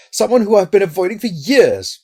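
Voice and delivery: high, loud, agitated voice; frightened outburst, pitched right up; panicked cry. A narrator's reading. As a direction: cry it out in dread. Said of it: "cried Loveday".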